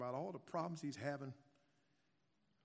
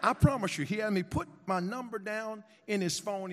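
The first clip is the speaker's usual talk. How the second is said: ordinary talk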